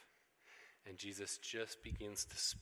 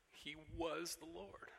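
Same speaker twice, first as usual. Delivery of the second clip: slow, higher "He was the Lord" with a rising "was"; 6 semitones higher, slower than usual. Real, not acted. Repeated climactic declaration.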